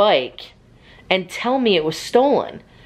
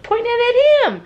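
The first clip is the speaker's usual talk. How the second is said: very high, singsong reply; 3 dB louder than usual. Real, not acted. Mother explaining the camera to partner, high baby talk. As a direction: answer in a high cooing voice. Still cooing toward Harrison.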